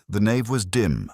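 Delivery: ordinary delivery, nothing marked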